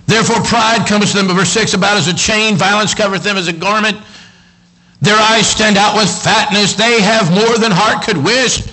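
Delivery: high, loud pulpit scripture reading; fired-up preacher building in intensity; full-force preaching. Acted. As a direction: declaim the verses, stretching the key words.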